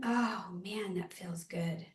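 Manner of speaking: breathy whispered relief; whispering. Real, not acted. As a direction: sigh it out in a whisper. Relieved sigh during stretch.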